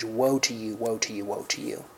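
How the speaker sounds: fading from soft lament to whisper; grieving lament. Shown as a demonstration. The preacher shows the cry of grief, fading to a whisper. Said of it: "a primary exclamation of grief".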